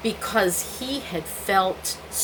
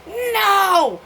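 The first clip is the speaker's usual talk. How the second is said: defiant no; 10 semitones higher, 5 dB louder than usual. Retold or imitated. Her refusal in quarrel.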